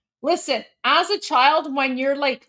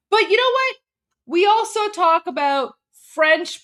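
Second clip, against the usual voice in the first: excited; 7 semitones higher, 5 dB louder than usual. Real, not acted. Excited pivot.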